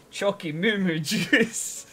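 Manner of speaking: slow, high, drawn out with a laugh; playful silly name. Real, not acted.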